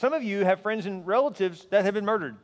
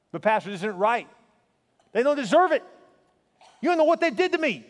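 high, loud protest of a wronged victim; impassioned objection; 5 semitones higher, 4 dB louder than usual. Acted. Pastor voicing a hurt churchgoer objecting to forgiveness, in loud, high indignation. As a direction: cry out against the unfairness, voice pitched up.

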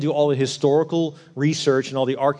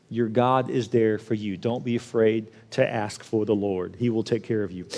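reassuring; 5 semitones lower, 5 dB quieter than usual. Acted. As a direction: tell them gently they're safe. Gentle reassurance after preaching.

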